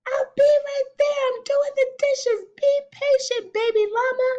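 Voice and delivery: high, loud mother llama calling up; calling back. A narrator's reading. Mama busy with the dishes answers baby llama, calling back. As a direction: shout it upstairs, high and projected. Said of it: "Mama says she'll be up soon".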